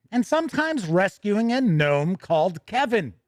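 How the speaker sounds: upbeat, brisk product-news read; a bright announcer reading the release. Acted. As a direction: present it like a news anchor. Reads the product news item.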